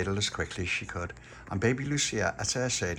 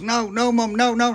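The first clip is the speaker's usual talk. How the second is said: put-on high, loud whining boy; a kid squirming away from the flannel; whiny protesting child; 14 semitones higher, 9 dB louder than usual. Son resists mum's flannel.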